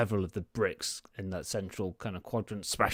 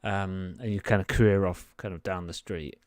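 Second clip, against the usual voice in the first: ordinary delivery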